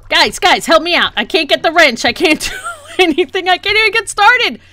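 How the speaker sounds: flustered pleading. Real, not acted. Begs viewers for help.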